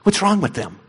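low, quiet mock indignation; mock self-righteous. Preacher mocking smug churchgoers, low quiet sneer. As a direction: sneer at the dirty pagans, hushed. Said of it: "and be like".

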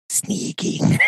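whispered sly sneaking; Gollum whisper. Retold or imitated. Gollum answering Sam slyly, whispered. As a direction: hiss it secretively like Gollum. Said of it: "And he's like".

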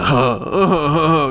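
hammed-up fake sobs; mock sobbing without tears; fake crying. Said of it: "he was trying to cry".

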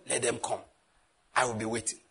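low, quiet, unbothered confidence; calm confident threat. Retold or imitated. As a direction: say it coolly, sure of yourself.

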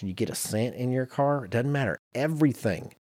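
usual speaking voice

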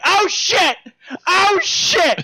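very high-pitched whooping exclamation; mock excited yell. Tipsy mom reacting to the action movie, high mock yell. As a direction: shriek it like an over-invested movie watcher. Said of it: "she's just like".

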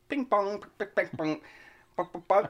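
mock Chinese accent, singsong and high; fake Chinese accent. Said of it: "switched to a fake Chinese accent".

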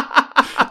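high, loud burst of laughter; big laugh. Real, not acted. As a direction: crack up loudly. Cracking up at the offensive comparison.

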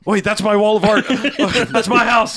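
high, laughing alarm; laughing panic. Retold or imitated. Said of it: "every step when he's like".

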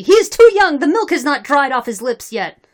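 high, loud yell, easing off; screamed objection. A narrator's reading. Rival party objecting to a young candidate, yelled. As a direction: yell the objection over the crowd. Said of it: "screamed another party".

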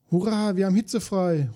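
high, delighted cheer; excited shout. A narrator's reading. Boy cheers to his father, excited and high. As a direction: shout it like school's out. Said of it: "ruft Florian begeistert".

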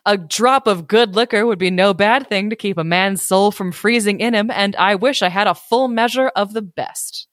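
ordinary delivery, nothing marked

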